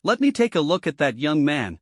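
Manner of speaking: ordinary delivery, nothing marked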